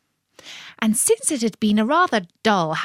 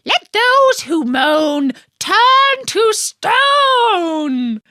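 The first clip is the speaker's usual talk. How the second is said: very high, slow, loud spell; wicked witch screeching a spell; 13 semitones higher, 6 dB louder, much slower than usual. Acted. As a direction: cast the curse with a shrieking cackle voice. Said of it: "she screeched".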